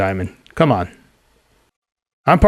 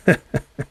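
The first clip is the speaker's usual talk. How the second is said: gloating chuckle; 4 dB quieter than usual. Acted. Thug gloats over captured detective.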